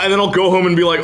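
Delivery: usual speaking voice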